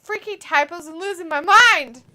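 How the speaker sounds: mock frantic yell. Reading out a viewer's chat comment, mock frantic yell.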